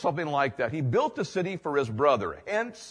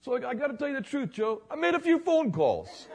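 much higher, fast, laughing admission; sly confession; 11 semitones higher than usual. Retold or imitated. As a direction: own up with a wink. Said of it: "He goes".

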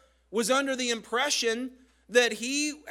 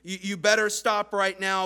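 louder, bossy scolding; stern warning; 6 dB louder than usual. Retold or imitated. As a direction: shut him down firmly. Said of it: "And so they say".

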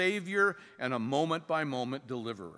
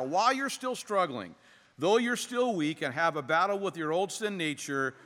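ordinary delivery

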